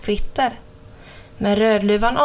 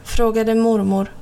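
ordinary delivery, nothing marked

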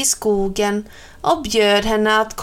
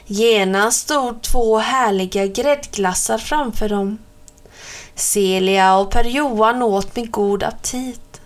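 ordinary delivery, nothing marked

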